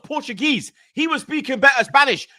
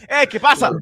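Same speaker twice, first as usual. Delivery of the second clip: mock girlfriend laughing; 4 semitones higher, 4 dB louder than usual.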